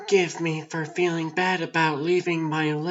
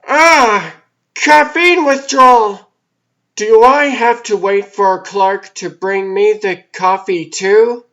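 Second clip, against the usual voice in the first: mock outburst; 2 semitones higher, 11 dB louder than usual. Hammed-up coffee craving outburst.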